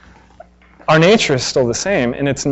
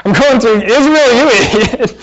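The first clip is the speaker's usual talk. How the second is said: higher, laughing through a mock scold; 7 semitones higher than usual. Real, not acted. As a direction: crack up mid-insult. Laughs while mock-scolding Israel.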